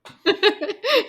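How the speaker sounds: petit rire aigu. Real, not acted. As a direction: rire qui monte. Rit de la blague. (rire aigu)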